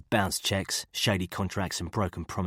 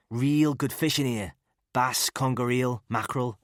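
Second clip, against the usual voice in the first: Scouse chat; 6 semitones higher, 4 dB louder than usual. A narrator's reading. Killen chatting in character voice.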